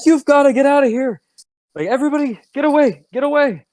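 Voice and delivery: high frantic scream; screaming Otis. Acted. Cook Otis screaming at Clint to flee, frantic and high. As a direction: yell it out in pure panic. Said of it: "he just screams at Clint. He's like".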